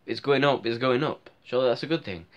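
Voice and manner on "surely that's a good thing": usual speaking voice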